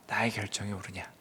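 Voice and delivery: low, soft question; quiet questioning. Acted. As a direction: drop the voice low and ask softly. God contrasts his decision, softly.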